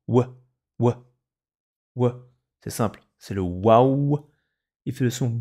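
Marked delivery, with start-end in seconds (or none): 0.1-2.3 "ouah" as a short clipped wa; wa sound. Shown as a demonstration. Teacher to pupils, drilling the letter's sound, short "ouah". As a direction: repeat "ouah" crisply three times. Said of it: "il fait aussi le son ouah".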